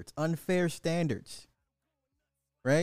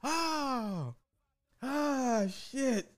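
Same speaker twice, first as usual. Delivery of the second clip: laughing; 11 semitones higher, much slower than usual. Real, not acted. Recovering from laughter.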